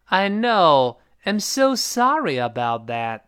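drawn-out, emphatic apology; apologetic model reading. Shown as a demonstration. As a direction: lead the repeat: long "know", stressed "so sorry". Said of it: "一块儿来啊".

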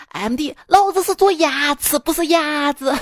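indignant Sichuanese correction; Sichuan dialect. Retold or imitated. Chengdu dentist correcting the friend, indignant Sichuan dialect. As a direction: protest in broad Sichuan dialect. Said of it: "说话就是四川话".

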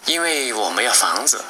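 ordinary delivery, nothing marked

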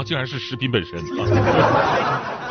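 ordinary talk

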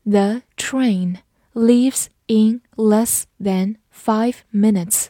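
word by word, with gaps between; slow, evenly spaced model reading for learners; slow read-through. Shown as a demonstration. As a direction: read it out slowly, each word separate. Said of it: "慢读一遍".

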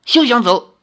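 high, loud cry to halt; commanding shout. A narrator's reading. Girl cuts off the fleeing spy, commanding shout. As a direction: call out sharply to stop him.

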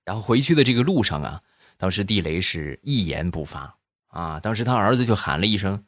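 ordinary talk, nothing marked

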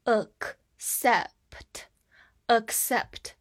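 "accept" in slow separate pieces, then blended whole; sounded out. Shown as a demonstration. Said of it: "第一个C读作k".

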